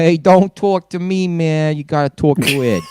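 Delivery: high, slow Boston wiseguy; Boston accent. Retold or imitated. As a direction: play a brash Boston guy. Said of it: "That's a pretty good Boston accent".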